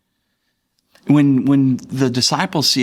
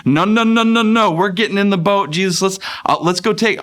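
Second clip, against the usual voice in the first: put-on panicked little boy; 9 semitones higher than usual. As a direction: squeal in mock panic, desperate to leave. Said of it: "they're like my boy".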